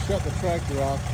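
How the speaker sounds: normal talking voice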